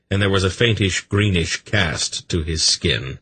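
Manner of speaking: plain reading voice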